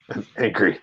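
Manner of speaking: low, soft grumble; subdued anger. Real, not acted. Fan let down by the missing shoe, low subdued anger. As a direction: drop it down into a quiet complaint.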